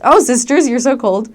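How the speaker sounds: imitated yelling. Mother of the house translated for listeners, mock yelling. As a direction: call it out like an over-loud hostess. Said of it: "sounds like she's yelling and angry".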